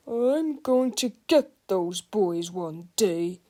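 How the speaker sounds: put-on a mean old principal swearing revenge; grim vow. Mean principal vows to get the boys, slow menacing. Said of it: "Mister Krupp vowed".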